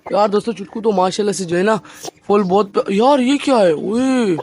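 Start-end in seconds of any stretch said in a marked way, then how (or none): 2.9-4.4 loud, startled exclamation; sudden surprise. Real, not acted. Vlogger spots his rooster's bald back, startled loud surprise. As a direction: blurt it out in shock at what you see.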